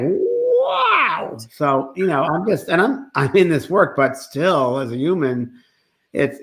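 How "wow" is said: amazed wow. Retold or imitated. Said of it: "And it's like".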